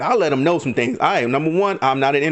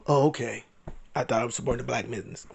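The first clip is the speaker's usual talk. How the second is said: much softer, lower mumbled reply; meek acceptance; 6 semitones lower, 9 dB quieter, faster than usual. Retold or imitated. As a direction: back down quietly, as if acting it out. Acting out a meek, quiet retreat.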